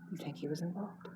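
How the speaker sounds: soft conspiratorial murmur; hushed speculation. Acted. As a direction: wonder it under your breath. Returns to hushed gossip.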